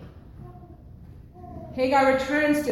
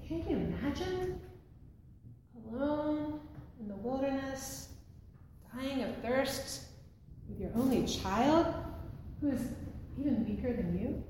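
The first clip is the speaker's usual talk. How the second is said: softer, with pauses between phrases; hushed empathy; 3 semitones higher, 7 dB quieter than usual. Real, not acted. Preacher asks the congregation to imagine Hagar alone, hushed. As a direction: let each phrase land quietly, inviting them to imagine.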